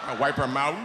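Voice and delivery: ordinary delivery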